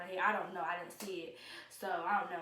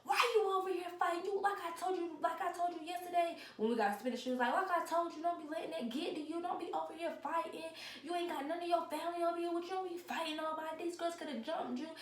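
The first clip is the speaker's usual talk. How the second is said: higher-pitched imitation of a scolding mother; mock scolding mom; 9 semitones higher than usual. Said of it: "she's cussing me out".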